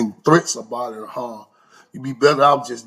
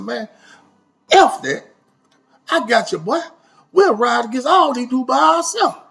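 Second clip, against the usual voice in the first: a lightly voiced pumped-up Slim, higher; hyped-up friend; 9 semitones higher than usual. Slim volunteering to fight the whole crew, mock hyped-up. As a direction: imitate a friend eager to brawl, pitched up.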